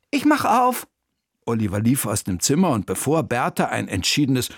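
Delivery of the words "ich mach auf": put-on high, loud boyish call; a young boy rushing off to the door; eager boy. (hoher, eifriger Jungenruf)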